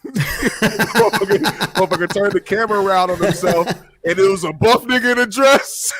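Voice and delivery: can't hold the laughter in telling it; laughing. Real, not acted. Host laughing while retelling the video, loud laughing.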